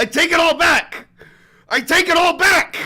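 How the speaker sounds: high, loud panicked take-back; panicked retraction. Real, not acted. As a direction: shout it out to undo the blunder. Retracting Nazi accusation.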